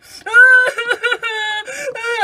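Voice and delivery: hammed-up crying laugh; laugh-cry burst. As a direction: cackle through fake tears. Cries on the slide.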